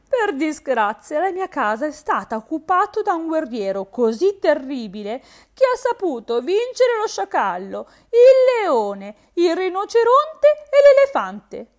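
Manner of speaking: tearful, rising high and loud in despair; distressed hare. A narrator's reading. The hare tells the frog her sorrows, tearfully and rising. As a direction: break into a wail as the list grows. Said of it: "afflitta e triste".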